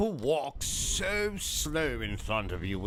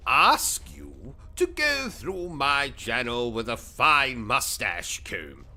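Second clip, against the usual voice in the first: ordinary delivery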